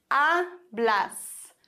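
hablas split, stressed A then blas; stress on first syllable. Shown as a demonstration. Teacher gives "du sprichst", stressing A in hablas. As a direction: lean on the A of hablas. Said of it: "die vorletzte Silbe betont".

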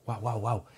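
three quiet little woofs, lightly imitated; hushed toy-dog barks; soft mock barking. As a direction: bark softly like a small obedient dog. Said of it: "qué me dice mi ama".

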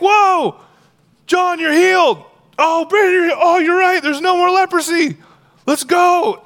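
high, loud burst of astonishment; amazed excitement. Acted. Lepers finding themselves healed on the way, amazed shouting. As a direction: exclaim it like sudden wonderful news.

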